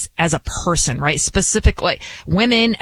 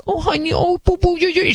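put-on high cooing baby talk to a crying child; doting mom; 9 semitones higher than usual. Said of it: "the mom was like".

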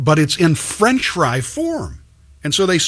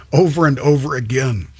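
ordinary talk

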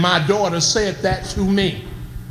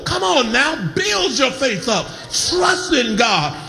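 raised, ringing preacher's cry over the organ; full-force preaching; 6 semitones higher than usual. Acted. Exhorting the congregation, music behind.

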